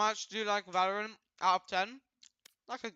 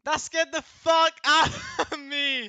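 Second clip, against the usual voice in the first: louder, laughing through the words; laughing shout; 5 semitones higher, 6 dB louder, slower than usual. Real, not acted. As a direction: yell it while breaking into laughter. Repeats it, laughing now.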